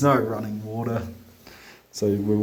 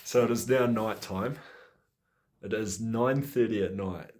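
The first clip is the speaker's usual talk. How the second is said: ordinary delivery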